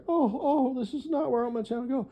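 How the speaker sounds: high, fast, laughing mock whine; mimicking her fretting about the channel in a high, rushed voice; mocking her dismay. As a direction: squeak it out fast like a fussy girl. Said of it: "and you were like".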